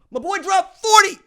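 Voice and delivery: loud, high-pitched brag; hyped shout. Real, not acted. Fan bragging about his player's forty points, hyped shout. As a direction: burst out shouting with fan pride.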